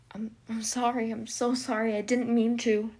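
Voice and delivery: rising, tearful apology; breaking down. Acted. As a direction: apologize as he starts to cry.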